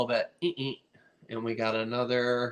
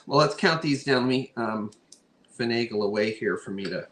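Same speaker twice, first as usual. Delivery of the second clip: normal talking voice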